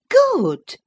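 high, loud exclamation; cried out. Acted. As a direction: cry it out angrily. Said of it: "cried the other".

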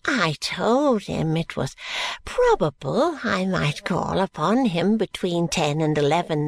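lightly voiced fussy old schemer; coaxing old-man persistence; urging old man. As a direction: press him about the appointment.

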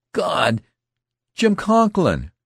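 high, loud cry of shocked recognition; horrified scream. A narrator's reading. Youth finding his wounded friend, screaming. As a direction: scream the name out in horror. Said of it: "the youth screamed".

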